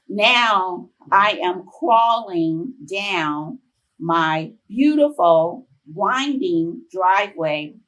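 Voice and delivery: crawling pace, each word dragged out; slow and drawn out. Retold or imitated. To the audience, recalling crawling down the driveway, slow and dragged. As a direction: stretch the words like you can barely move. Telling of crawling after the fall.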